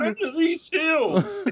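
high, slow cartoon prospector drawl; old prospector. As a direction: play a cartoon mountain prospector. Continuing the prospector bit.

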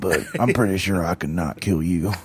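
low, soft creepy confession; quiet, low would-be killer voice; creepy kidnapper. Pretend. Creep admitting an accident to his captive, low and hushed. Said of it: "that has to be what he sounds like".

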